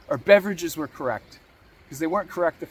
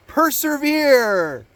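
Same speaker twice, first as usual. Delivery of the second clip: slow, higher and louder, stretched out; drawn-out urging; 8 semitones higher, 5 dB louder, much slower than usual. Acted. Coach telling a doubting viewer to persevere, stretched and loud. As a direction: lean hard on every syllable.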